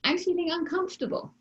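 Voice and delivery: high, soft, understated admission; gentle voice without drama; toned down. Acted. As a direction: say it small, high and softly. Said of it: "How could you tone it down?".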